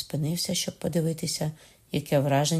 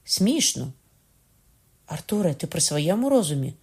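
raised, incredulous questioning; stunned disbelief; 3 semitones higher than usual. A narrator's reading. James to Arthur, too astonished to be angry, incredulous. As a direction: question him as if he has lost his mind. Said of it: "занадто вражений, щоб розсердитися".

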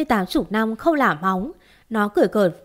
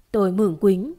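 ordinary delivery

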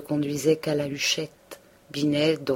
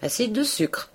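higher, mocking repeat; disdainful; 7 semitones higher than usual. A narrator's reading. The pharmacist disdainfully repeating Binet's term. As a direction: scoff at the wrong name. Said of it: "fit le pharmacien dédaigneusement".

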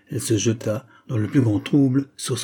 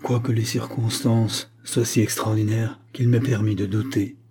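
usual speaking voice

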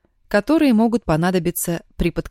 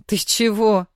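surprised; 3 semitones higher than usual. Acted. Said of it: "Аня удивлённо вскинула брови".

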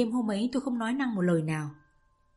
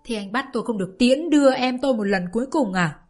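anguished protest; 2 semitones higher, 4 dB louder than usual. Acted. Grieving lover protesting to his friend. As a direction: protest in bitter grief.

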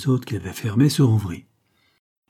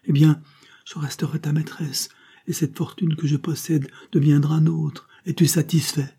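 passionate offer; 6 semitones higher than usual. Acted. Carmela offers herself, pleading.

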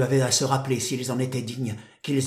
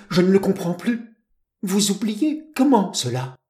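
higher, louder, desperate outpouring of love; impassioned protest; 6 semitones higher, 6 dB louder than usual. Acted. Rejected lover pleading with the woman he adores, impassioned protest. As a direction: plead urgently, pitched up and forceful.

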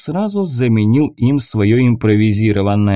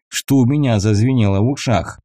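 usual speaking voice

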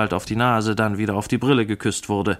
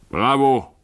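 hearty, louder cheer of bravo; one loud higher-pitched exclamation; calling out; 4 semitones higher, 6 dB louder than usual. A narrator's reading. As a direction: call out approval, pitched higher. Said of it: "rief Mister Pickwick".

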